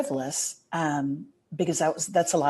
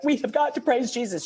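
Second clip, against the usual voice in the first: higher, eager call to worship; rally the child to praise, pitched up high; excited insistence; 8 semitones higher than usual. Retold or imitated. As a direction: mom urging her daughter to praise Jesus, higher and eager. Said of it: "I drag her to the living room and I'm like".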